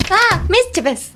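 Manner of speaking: high exclaimed "Ah" running into "mischievous" with a clicked "che"; frustrated-click pronunciation. Shown as a demonstration. Teacher modelling for repetition, "Ah, mischievous" as a high frustrated exclamation. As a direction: blurt "Ah, mischievous" as if annoyed at a mistake. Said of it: "Repeat after me".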